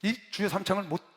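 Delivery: normal talking voice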